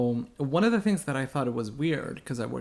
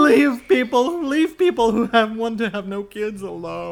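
insistent outcry; 8 semitones higher, 8 dB louder than usual. Pretend. Defending people who want no kids, loud insistent cry. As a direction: push it out high and loud. Pleading the childfree people's case.

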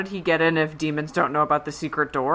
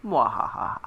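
whispered evil mwahaha; a breathy villain cackle; sneering panto villain laugh; 4 semitones lower, 3 dB quieter than usual. Pretend. Villain return joke.

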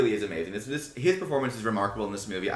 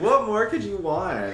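laughing; 10 semitones higher, 5 dB louder than usual. Real, not acted. Laughing at the joke.

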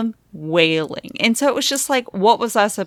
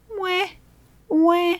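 mock baby cry; 13 semitones higher than usual. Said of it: "they're like".

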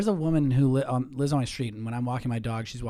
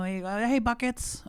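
high, sing-song coo to a dog; the old lady greeting the dog, pitched way up; 10 semitones higher, 4 dB quieter than usual. Retold or imitated. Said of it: "She, like, talks to my dog".

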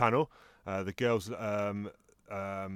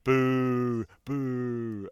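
mock booing; 4 semitones higher, 6 dB louder than usual. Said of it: "Patty's going".

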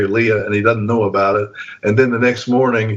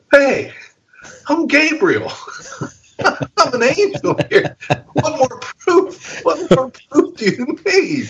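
high, slow mock-exasperated angel voice; slower than usual. Angel to doubting Zechariah, high mock exasperation. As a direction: play the fed-up angel showing off. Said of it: "I think what he's saying is".

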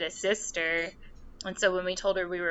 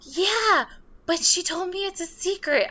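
much higher, louder and faster friend voice; imitating gossipy friend; 11 semitones higher, 5 dB louder than usual. Friend texting about meeting mom, squealed higher and louder.

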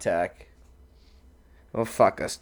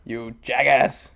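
ordinary talk, nothing marked

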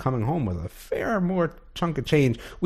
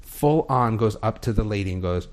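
ordinary talk, nothing marked

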